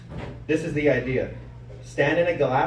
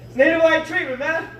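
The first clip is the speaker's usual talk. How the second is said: excited shout; 10 semitones higher than usual. Retold or imitated. Shouting over imitated siren.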